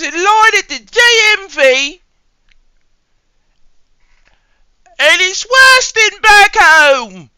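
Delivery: very high, loud mock British whine; squeaky channel-host character. Host greeting viewers about the D M V, squealed cartoon British. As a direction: complain in a piercing cartoon British voice. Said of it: "if I talked like that all the time".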